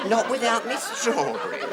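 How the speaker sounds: laughing. Real, not acted. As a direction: savour the absurd line. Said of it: "Completely illogical line".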